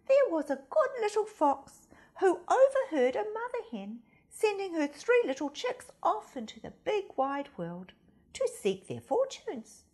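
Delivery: plain reading voice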